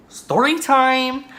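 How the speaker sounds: very high, loud and stretched catchphrase; playful drawn-out announcement. Pretend. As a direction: hold the last word long and high. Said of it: "Like, just say it".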